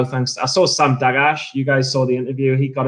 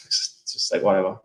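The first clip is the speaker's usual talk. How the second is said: dismissive whisper; 6 semitones lower, 6 dB quieter than usual. Real, not acted. Lazy dismissive shrug.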